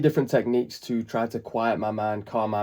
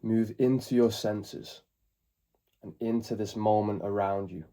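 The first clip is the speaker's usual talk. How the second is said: calm meditation guide, long pauses between phrases; spacious, settling voice, growing softer toward the end; guided meditation; 4 dB quieter than usual. Acted. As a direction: ease them into the present moment, unhurried.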